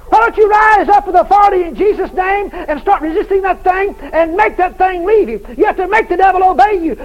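high, loud hammering sermon; fiery shouted preaching; full-force preaching. Real, not acted. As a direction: pound it into the crowd, over and over.

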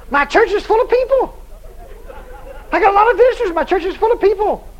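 high-pitched mock amazement; excited pastor. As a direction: imitate a pastor thrilled and astonished. Pastor's amazed report acted out.

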